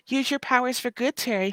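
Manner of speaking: high, sing-song mock voice; mock advice voice. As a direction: chirp it like a playful voice teasing Terry.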